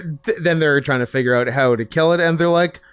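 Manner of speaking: normal talking voice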